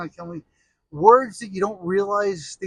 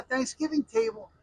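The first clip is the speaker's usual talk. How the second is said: ordinary talk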